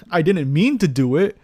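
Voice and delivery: loud, flustered protest; guilty nervousness. Real, not acted. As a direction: blurt it out in guilty panic. Panicked denial after kill.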